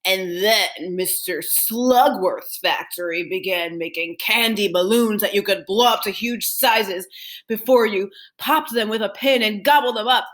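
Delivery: ordinary delivery, nothing marked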